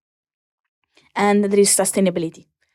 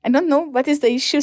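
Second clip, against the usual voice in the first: mock naive; 8 semitones higher than usual. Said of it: "I was like".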